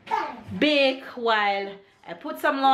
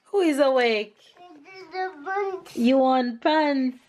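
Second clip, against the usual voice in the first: slow sing-song question; 8 semitones higher, slower than usual. Real, not acted. Asking waking toddler.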